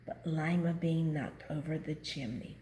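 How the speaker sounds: ordinary delivery, nothing marked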